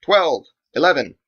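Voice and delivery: crisp shouted orders; calling out. Acted. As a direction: call the targets like a commander. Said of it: "She called out the target order".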